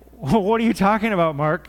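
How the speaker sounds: loud, incredulous listener's objection; mock bafflement. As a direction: challenge him as if he makes no sense. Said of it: "you'd look at me like".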